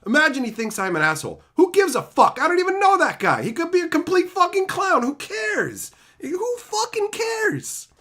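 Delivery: high, loud, scornful telling-off; retold loud rant. Telling the Arby's lady how he deals with haters, loud and scornful. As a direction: brush the haters off at full volume. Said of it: "I said".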